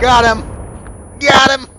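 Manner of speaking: yelling in triumph; excited shout. Real, not acted. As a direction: shout it out, thrilled. Excited kill shout in game.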